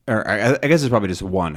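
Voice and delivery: ordinary talk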